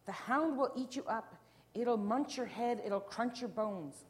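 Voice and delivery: dramatic warning, slowing at the end; frantic warning. A narrator's reading. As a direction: warn with relish of horrible danger. Panicked family warns the little girl.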